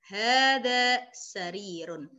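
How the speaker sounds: "hadza miftahun" spoken slowly, each syllable clear; Arabic model reading. Shown as a demonstration. Teacher to online students learning Arabic, slow clear model reading. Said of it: "teacher models Arabic reading".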